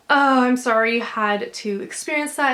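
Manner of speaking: normal talking voice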